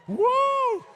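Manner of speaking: high, loud whoop of joy; excited cheer. Real, not acted. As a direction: cheer for the winner at full voice. Winning bidder cheers.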